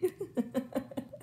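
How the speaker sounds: high giggle; helpless giggle; giggling fit. Real, not acted. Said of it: "I can't stop laughing".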